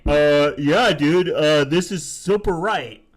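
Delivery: loud, slow dopey bro voice; mock dumb bro. Mocking commenters who think they're right, slow loud dumb bro. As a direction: play the clueless dude, slow and loud. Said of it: "then they get like".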